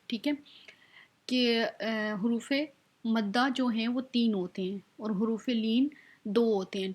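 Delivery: usual speaking voice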